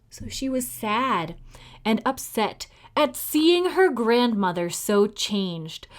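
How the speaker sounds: usual speaking voice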